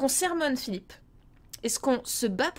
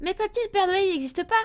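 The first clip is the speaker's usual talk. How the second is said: put-on much higher little kid voice; child voice; 8 semitones higher than usual. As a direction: play a doubting grandchild. Child voice talking to grandpa.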